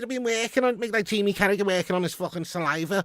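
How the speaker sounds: high, energetic Scouse patter; broad Liverpool Scouse accent. Shown as a demonstration. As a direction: put on thick, high-pitched Scouse. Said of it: "sound like my fucking Scouse accent".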